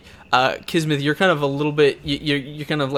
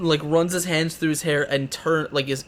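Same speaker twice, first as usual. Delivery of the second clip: ordinary talk, nothing marked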